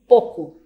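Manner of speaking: "pouco" said as poco, the diphthong flattened, loud; monophthong pouco. Shown as a demonstration. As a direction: demonstrate the casual "pouco" as poco. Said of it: "muitas falam".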